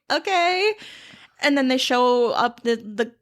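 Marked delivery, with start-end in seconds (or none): 0.1-0.7 high, drawn-out okay; mock unimpressed. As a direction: say okay with a raised brow. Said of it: "Elphaba's like".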